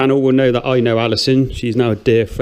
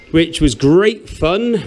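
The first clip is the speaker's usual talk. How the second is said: drawn-out, sarcastic fake enthusiasm; 3 semitones higher, much slower than usual. Real, not acted. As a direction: stretch it out with heavy sarcasm. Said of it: "Recognise the sarcasm in my voice".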